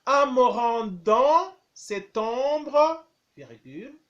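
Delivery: slow, clear dictation, phrase by phrase; dictating. Shown as a demonstration. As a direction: dictate each chunk slowly for pupils. Teacher dictating, repeating phrases.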